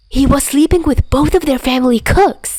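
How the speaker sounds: high, breathless gossip voice, over-eager; a wired friend spilling juicy gossip; high-pitched, giddy storyteller; hyper gossip. Acted.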